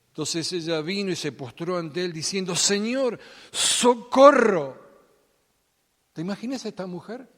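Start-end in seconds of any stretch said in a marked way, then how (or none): 2.6-4.9 un grito largo y estirado, más alto y mucho más fuerte; desesperado grito de socorro. Acted. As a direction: clama socorro con desesperación. Mujer clama a Jesús. (grito desesperado de la mujer pidiendo ayuda)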